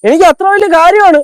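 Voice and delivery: high, fast, worked-up protest; agitated outburst. Acted. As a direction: get indignant and defend your honour. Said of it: "നീ ഇങ്ങനെ ക്ഷുഭിതനാവാതെ".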